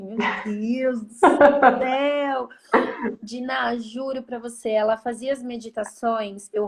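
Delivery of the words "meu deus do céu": loud, drawn-out mock dismay; half-laughing cry of exasperation; exasperated exclamation. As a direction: stretch it out in amused disbelief. Exasperated retold reaction, laughing.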